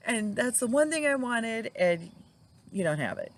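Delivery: high, indignant teasing of the waitress; mock outraged husband. Husband needling the waitress over a missing dish, high mock outrage. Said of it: "he loved to harass the waitresses".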